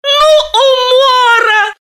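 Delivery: high, slow bursting laugh. A narrator's reading. Galina bursts out laughing at the surname, high laughing cry. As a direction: crack up with laughter. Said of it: "рассмеялась Семёнова".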